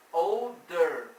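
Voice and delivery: put-on OLDER broken into "ol" and "der", loud and slow; teacherly, drawn-out "older" in two loud pieces; split syllables. Shown as a demonstration. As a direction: punch each half of "older" separately. Teacher splits word into its parts.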